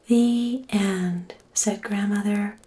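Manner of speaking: plain reading voice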